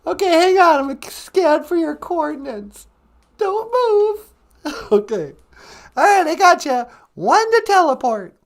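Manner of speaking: loud, very high put-on operator voice; high-pitched Natasha character. Natasha scanning coordinates to teleport him, high put-on voice. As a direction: call out instructions in falsetto.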